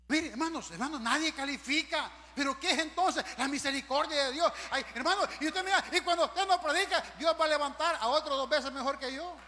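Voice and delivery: loud, rousing pulpit voice; full-force preaching. Acted.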